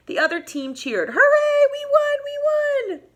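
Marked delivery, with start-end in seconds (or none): 1.1-3.0 high, loud victory cheer. Acted. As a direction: whoop it up like winning kids. Team cheering.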